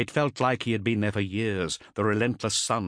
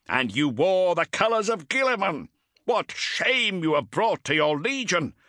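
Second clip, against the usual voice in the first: much higher, louder drill sergeant shout; shouting taskmaster; 9 semitones higher, 3 dB louder than usual. Acted. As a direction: bellow the charge at the coward. Taskmaster keeps berating Cestus.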